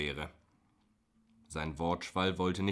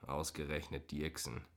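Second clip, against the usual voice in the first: usual speaking voice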